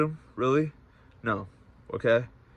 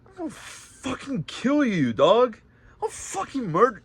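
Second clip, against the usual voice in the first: much higher, strained furious threat; retold rage, voice breaking into a cry; enraged threat; 11 semitones higher than usual. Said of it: "I was like".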